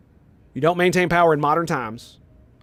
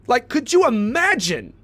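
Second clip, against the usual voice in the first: higher, slower exasperated disbelief; real heated rant peak, pitched up; 7 semitones higher than usual. Real, not acted. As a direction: stretch it out, pitched up and fed up. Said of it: "sorry to get so heated".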